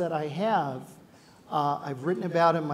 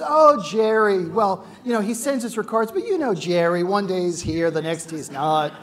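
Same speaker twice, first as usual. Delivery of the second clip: loud, breezy mock innocence; innocent cover story; 3 semitones higher, 9 dB louder than usual. Wife covering for the guest she sent away, loud and breezy. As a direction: play it innocent, a little too loud. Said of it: "she goes".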